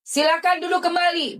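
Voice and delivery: high, formal court instruction; court judge. Retold or imitated. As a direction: invite the room to sit, high and formal. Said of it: "Hakimnya duduk". (hakim mempersilakan duduk, suara tinggi resmi)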